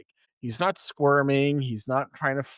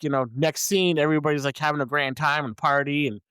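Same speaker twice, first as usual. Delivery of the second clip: ordinary talk, nothing marked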